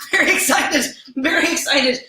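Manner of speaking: very excited; giddy excitement. Real, not acted. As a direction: repeat it, bubbling with excitement. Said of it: "Sorry, I got excited".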